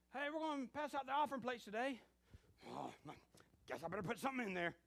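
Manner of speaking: high, loud announcement then grudging sigh; mock the stingy giver; reluctant churchgoer. Churchgoer at offering time.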